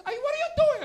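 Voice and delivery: high loud mom voice; shrill mom shout. Retold or imitated. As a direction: mom yelling through the door. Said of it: "She goes".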